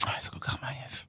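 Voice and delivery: very soft, voiceless sigh of fatigue; weary whisper. Real, not acted. Fan worn out by endless championships, weary whisper. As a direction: breathe it out, worn out.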